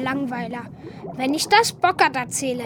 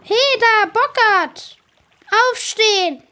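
calling out; 4 semitones higher, 5 dB louder than usual. Acted. Waking the beaver up.

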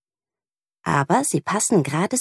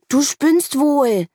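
put-on higher, slow, indignant boy; upset boy; 9 semitones higher, 4 dB louder, much slower than usual. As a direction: drag out the outrage. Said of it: "regt sich Johannes auf". (empört, höher und langsam)

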